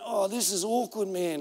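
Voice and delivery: hushed, embarrassed thought; softly retold awkward thought; awkward inner aside. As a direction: say it under your breath, as if cringing privately.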